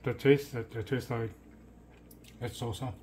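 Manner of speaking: talking between chews; muffled words while eating; mouth full chewing. Real, not acted. Seen 0: Bites fake chicken at -7; lips and cheeks keep working while speaking.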